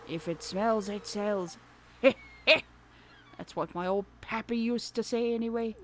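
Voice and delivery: loud hearty sailor voice with a laugh; folksy old salt selling fish; old fisherman. Pretend. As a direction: fisherman touts his shop, hearty. Voicing Willy.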